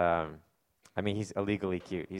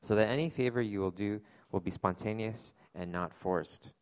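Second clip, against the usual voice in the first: measured, steady public reading of scripture; 3 semitones lower, 5 dB quieter than usual. Acted. Pastor reading Philemon aloud to his church, in an unhurried scripture-reading voice. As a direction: read the letter aloud to the congregation.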